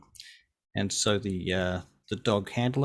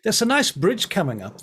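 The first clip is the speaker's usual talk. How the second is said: Francesco's eager voice; 9 semitones higher, 5 dB louder than usual. Acted. Francesco keeps touting the route.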